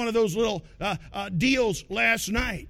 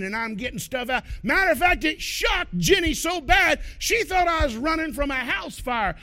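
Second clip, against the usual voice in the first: ordinary talk, nothing marked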